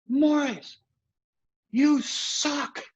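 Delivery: high, laughing heckler taunt; mock heckling. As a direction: jeer at him from the bleachers. Fans heckling from bleachers.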